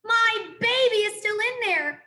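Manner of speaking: high, loud, frantic mother; terrified mother crying out; desperate cry. A narrator's reading. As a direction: cry it out in panic. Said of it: "cried a mother hare".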